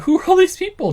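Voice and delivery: angry officer losing his patience; exasperated shout. Acted. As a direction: blast it out in disbelief.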